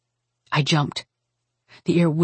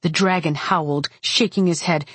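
plain reading voice

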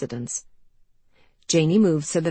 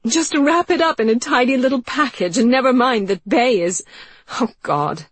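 hysterical laughter; 8 semitones higher, 7 dB louder than usual. Acted. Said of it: "she was laughing. Wild, uncontrollable laughter".

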